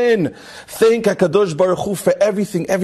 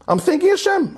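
"thanking" pitched way up and pressed hard; emphatic, much higher; 13 semitones higher than usual. Acted. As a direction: repeat it with rising fervour, up high on "thanking". Donor repeats, more emphatic.

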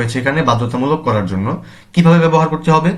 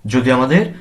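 ordinary delivery

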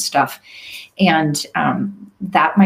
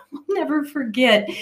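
usual speaking voice

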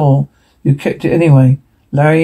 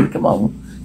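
whisper; 8 dB quieter than usual. Acted. Urging escape quietly.

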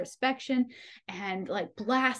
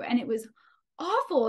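normal talking voice

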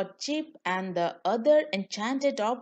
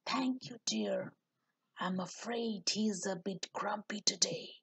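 soft aside; 9 dB quieter than usual. A narrator's reading. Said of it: "hopped over to whisper".

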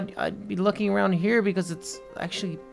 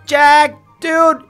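louder, raised call; 4 semitones higher, 8 dB louder than usual. Acted. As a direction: call for your missing friend. Calling for missing friend.

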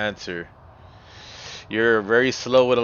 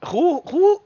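startled. Real, not acted. Reacting to a chat message.

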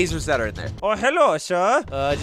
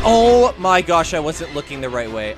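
usual speaking voice

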